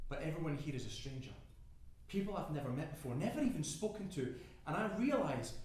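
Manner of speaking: usual speaking voice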